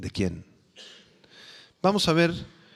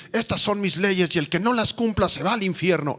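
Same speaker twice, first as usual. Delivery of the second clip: a lightly voiced stern, threatening God; mock harsh threat; 5 semitones higher than usual. Preacher mocks a mistaken view of God, stern threat. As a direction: caricature the false image of a punishing God. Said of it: "La intención de Dios no es decir".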